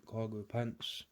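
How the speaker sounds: normal talking voice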